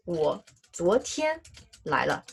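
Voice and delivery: very slow, split into two chunks with a pause; slow broken model. Shown as a demonstration. Teacher slowly breaks the sentence down for students. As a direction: spell it out piece by piece. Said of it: "for example, like".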